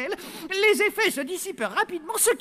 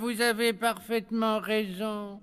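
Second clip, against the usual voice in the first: robotic servant; 5 semitones lower, slower than usual. Acted. Hypnotized servant obeys.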